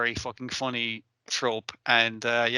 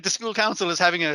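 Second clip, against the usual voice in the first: normal talking voice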